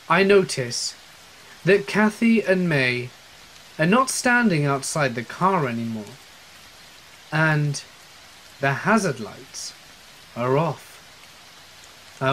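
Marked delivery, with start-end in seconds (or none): none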